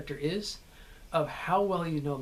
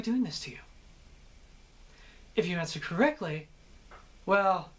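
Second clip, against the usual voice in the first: lightly voiced mocking captor questioning his prisoner; a hint of a cruel captor toying with Theon; taunting torturer; 3 semitones higher than usual. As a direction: toy with the prisoner, playful menace. Addresses Theon in the villain role.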